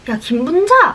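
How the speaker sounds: high-pitched mock yell of a name; angry call. Angry girl confronts friend in classroom, calling out. 화가 나서 친구를 부름.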